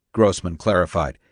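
plain reading voice

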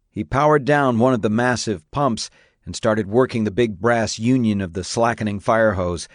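ordinary delivery

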